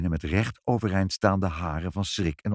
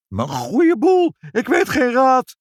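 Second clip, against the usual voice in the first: very high, loud, slow wail; panicked lament; 14 semitones higher, 7 dB louder, slower than usual. A narrator's reading. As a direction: wail in helpless distress. Mevrouw panics as house burns. (hoog, luid paniekerig gejammer)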